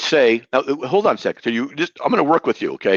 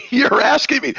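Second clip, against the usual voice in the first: higher voice breaking into a laugh; 13 semitones higher, slower than usual. Real, not acted. Debater answers opponent's absurd question, laughing. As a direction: laugh through the incredulous words.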